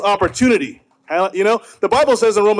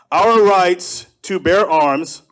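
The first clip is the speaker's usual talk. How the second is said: slow call line; much slower than usual. Shown as a demonstration. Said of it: "So I'm gonna say".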